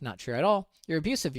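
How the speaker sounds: usual speaking voice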